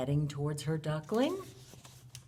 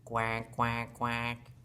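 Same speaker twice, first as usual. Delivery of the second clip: low, level duck quacks; low-pitched imitation of a duck; duck quacking; 9 semitones lower, much slower than usual. Shown as a demonstration. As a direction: quack three times like a duck. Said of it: "Can you quack with Miss Debbie?".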